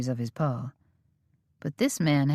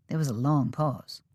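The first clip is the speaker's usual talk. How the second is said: usual speaking voice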